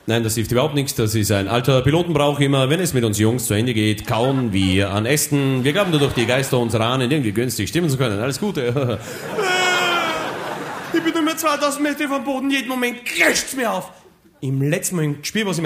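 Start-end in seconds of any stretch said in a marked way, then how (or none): 0.1-9.0 low, calm veteran pilot voice; erfahrener Pilot. Acted. Pilot erklärt Brauch. 9.0-10.9 shrill falling scream; high panicked scream. Acted. Panischer Schrei im Sturz. 10.9-13.9 panicked high. Acted. Absturz kurz bevor.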